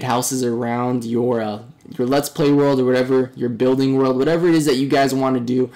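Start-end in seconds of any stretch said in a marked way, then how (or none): none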